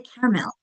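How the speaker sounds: "caramel" in two syllables, CAR-mel; middle syllable skipped. Shown as a demonstration. Teacher showing her Long Island way, "caramel" squeezed to CAR-mel. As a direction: drop the middle vowel of "caramel". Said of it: "We skip that middle syllable".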